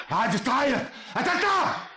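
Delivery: very high, frenzied cry; crazed confession. Acted. Killer confessing the murder to terrified woman, crazed and high. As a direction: shriek the confession, out of control.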